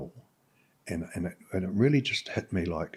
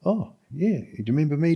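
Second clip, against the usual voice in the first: cheery greeting; 9 semitones higher, 6 dB louder than usual. Retold or imitated. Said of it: "and it's like".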